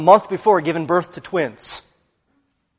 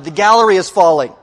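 louder, higher quoted warning cry; retold alarm shout; 4 semitones higher, 9 dB louder than usual. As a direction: call out the warning as a bystander would, half-shouted. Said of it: "Someone below shouted".

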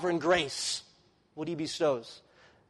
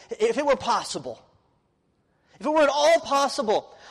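fervent wish; 6 semitones higher, 3 dB louder than usual. Acted. Preacher voicing Paul's fervent wish.

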